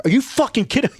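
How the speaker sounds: high-pitched disbelief, barely held in; incredulous outburst. Retold or imitated. Retelling his blow-up at being sent to a ship, high incredulous outburst. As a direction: blurt it out in high, incredulous protest. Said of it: "I can't hold it in".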